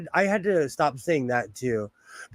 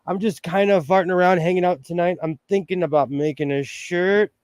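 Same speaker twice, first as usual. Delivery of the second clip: ordinary delivery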